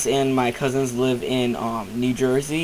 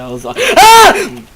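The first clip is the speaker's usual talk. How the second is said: startled scream; 12 dB louder, slower than usual. Real, not acted. Startled by a creeper in game.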